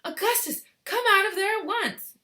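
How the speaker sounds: high, loud commanding call; shouted order. Acted. Mother shouting at son in the pipe. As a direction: demand he come out.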